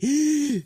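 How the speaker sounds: sudden sharp choke on a drink; choking gasp. Real, not acted. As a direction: choke mid-sentence. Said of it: "That gasp you left ... authentic choking gasp".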